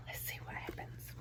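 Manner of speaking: hushed unvoiced whisper. Real, not acted. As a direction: whisper to build suspense. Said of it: "whispered to class".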